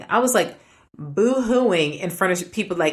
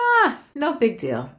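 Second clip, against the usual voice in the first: higher, drawn-out retold dismissal; modest brush-off; 6 semitones higher than usual. She waves away thanks for her service, modest brush-off. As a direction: shrug it off slowly, pitched up.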